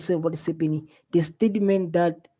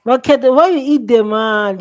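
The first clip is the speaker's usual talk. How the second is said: louder and higher, scolding someone on screen; exasperated loud scolding; 5 semitones higher, 9 dB louder than usual. Real, not acted. Streamer scolding Cat Matlala on screen, loud and exasperated. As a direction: call him out in disbelief.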